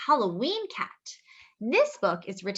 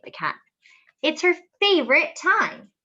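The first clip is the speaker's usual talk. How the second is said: usual speaking voice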